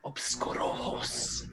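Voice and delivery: slow, hushed incantation; whispered spell. Acted. Arlo casts blindness on two skeletons, slow whisper. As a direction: whisper the spell word, drawn out. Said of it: "and, uh, whisper".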